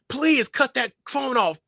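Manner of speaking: high, slow exasperated plea; mildly imitating an annoyed director; mad director. Director to crew as phone interrupts scene, mad. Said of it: "the director's getting mad".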